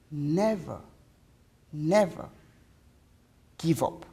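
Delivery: low, soft, spaced-out words; solemn advice. Acted. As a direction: press each word gently, with long pauses.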